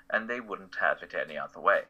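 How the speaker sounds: ordinary talk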